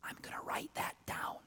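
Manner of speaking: a soft secret whisper. Retold or imitated. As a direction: lean in and whisper it. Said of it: "she whispered".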